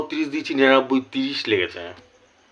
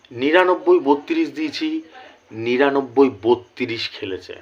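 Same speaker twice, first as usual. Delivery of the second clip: slow, measured, emphatic number call; emphatic result announcement; slower than usual. Real, not acted. Vlogger announces a winning number to viewers, slow and emphatic. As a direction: announce each number slowly and proudly.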